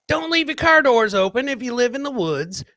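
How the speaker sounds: normal talking voice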